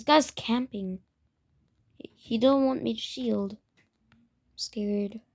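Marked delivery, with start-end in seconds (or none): 4.1-5.2 hushed, scared whisper; mock scared whisper. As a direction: whisper it like you're hiding. Same words whispered as a joke.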